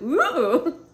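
loud, high surprised ooh; startled high ooh. Retold or imitated. Answering the border guard, loud high startled ooh. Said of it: "And I went".

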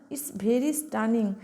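very slow, stretched example sentence; drawn-out example. Shown as a demonstration. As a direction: stretch out every word of It's very stunning. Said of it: "বলি না".

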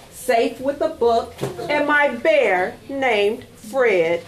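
usual speaking voice

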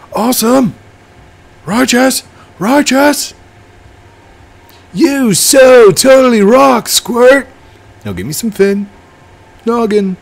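very high, loud hyped-up character voice; surfer dude character. Acted. Surfer character cheers on Squirt, high and excited. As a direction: shout it in a high, gleeful surfer voice.

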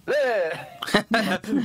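silly monster noise. Retold or imitated. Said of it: "doing jazz hands and going".

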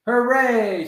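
loud, high hooray; team cheer. A narrator's reading. Coach and team cheering before the game, loud. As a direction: cheer it like the whole team at once. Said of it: "screamed the dream team".

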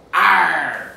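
loud, long pirate "Arr!"; mock pirate roar; pirate cry. Pirate cry before the song.

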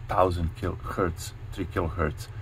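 ordinary talk, nothing marked

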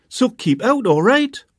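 high, loud telling-off; mother snapping at her son from the door; scolding mum. A narrator's reading. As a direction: scold him for not waiting.

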